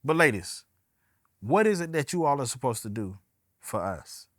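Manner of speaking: usual speaking voice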